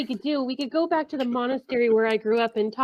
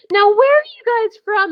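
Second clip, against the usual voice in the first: loud, higher call across the room; really loudly; 8 semitones higher, 9 dB louder than usual. Acted. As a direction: boom the question to be heard by all. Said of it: "I'm gonna say it really loudly".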